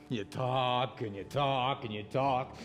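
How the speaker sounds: slow, low, dragging singsong; drunken scorn. Acted. Drunk man accusing churchgoers of empty talk, slow scorn. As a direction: drag out each repeat with contempt.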